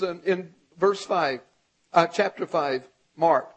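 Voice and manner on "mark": ordinary talk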